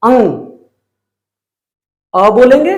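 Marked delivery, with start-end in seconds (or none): 0.0-0.4 अंग with the vowel pushed out through the nose, more nose than mouth; nasal demo sound. Shown as a demonstration. Teacher showing students how अंग sounds, nasal demo. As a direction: say अंग slowly and clearly, mostly through the nose. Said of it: "इसका हम उच्चारण करेंगे".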